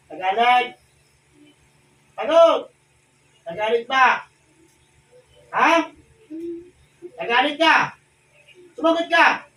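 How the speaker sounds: shouted interrogation. Real, not acted. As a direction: hammer the spirit with questions. Exorcist interrogating a spirit.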